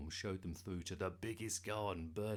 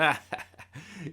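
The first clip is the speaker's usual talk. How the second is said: loud, higher mocking laugh; burst of laughter; 8 semitones higher, 11 dB louder than usual. A narrator's reading. Darren scoffing at Bertie's boast, bursting out laughing. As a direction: burst out laughing at a friend's claim. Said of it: "Darren burst out laughing".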